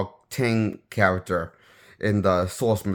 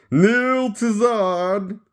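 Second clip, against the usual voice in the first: high, drawn-out shouted command; Zod; 12 semitones higher, 5 dB louder, much slower than usual. Acted. Reviewer quoting the Superman two villain, high slow shout. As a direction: bellow it slowly, high and commanding. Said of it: "That's from Zod from Superman two".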